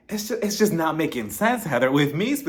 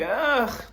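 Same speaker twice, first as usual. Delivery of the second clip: high, drawn-out groan; exasperated groan; 9 semitones higher than usual. Retold or imitated. Reacting to the mother comparison, exasperated high groan.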